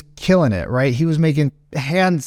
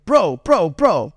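lightly voiced big dude interjecting, raised pitch; big Middle Eastern guy; 3 semitones higher, much slower than usual. Friend tries to calm the angry customer, imitated insistently. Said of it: "Middle Eastern guy, big dude".

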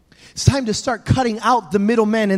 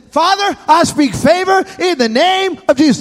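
put-on higher boy's declaration; son praying; 6 semitones higher than usual. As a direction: speak favor like the boy. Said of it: "He said".

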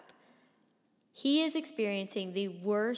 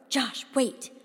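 louder, urgent dad's call; urgent warning; 3 semitones higher, 6 dB louder than usual. A narrator's reading. Dad stopping Josh at the door, urgent. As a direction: stop him with an urgent call. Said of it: "The urgency in Dad's voice".

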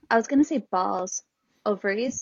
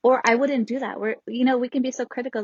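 usual speaking voice

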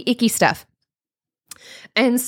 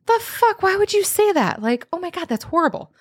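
much higher, outraged mock disbelief; indignant disbelief; 10 semitones higher than usual. Reacting to a hurtful online comment, appalled and high. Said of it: "where I'm like".

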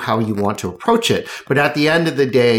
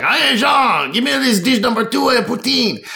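busy bartender calling out; 9 semitones higher than usual. Acted. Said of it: "He's like".

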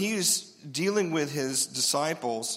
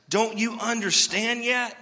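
slow, loud, high exasperation; going off on them; 6 semitones higher, 4 dB louder, slower than usual. Acted. Jesus exasperated with slow disciples, slow loud rebuke. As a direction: drag it out in disbelief. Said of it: "went off on them".

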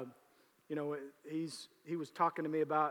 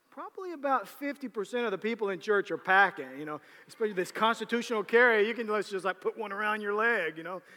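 excited retort; 5 semitones higher, 8 dB louder than usual. Retold or imitated. Pastor's own reply, excited.

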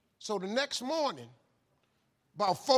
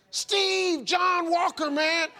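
much higher, louder, bursting with energy; over-the-top cheerful; 11 semitones higher, 7 dB louder, much slower than usual. Retold or imitated. John waking Steve with a call, booming and cheerful. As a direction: boom it out like a hype man at dawn. Impersonating energetic morning caller.

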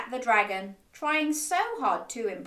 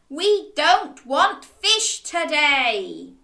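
slow, higher, louder stubborn protest; stubborn child digging in; defiant refusal; 4 semitones higher, 6 dB louder, slower than usual. A narrator's reading. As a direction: hammer out a cross refusal, word by word. Cross boy refusing the fisherman.

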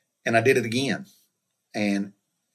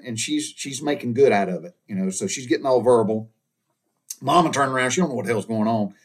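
ordinary talk, nothing marked

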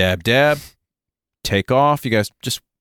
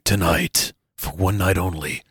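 movie trailer voice; slower than usual. Shown as a demonstration. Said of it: "Should introduce movies".

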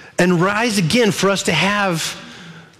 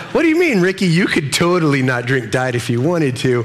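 mock teasing congregant; 6 semitones lower than usual. Congregant ribbing the pastor about his Diet Coke, mock teasing.

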